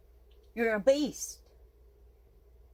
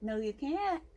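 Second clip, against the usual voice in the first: ordinary delivery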